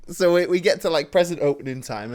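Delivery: ordinary talk, nothing marked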